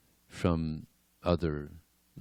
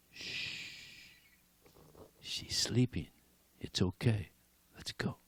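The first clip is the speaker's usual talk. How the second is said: soft shush, then whispered words; hushed whisper; 3 semitones higher, 9 dB quieter, slower than usual. Acted. Krishna whispering to his friends beside the sleeping Mataji. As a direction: keep it down so she won't wake.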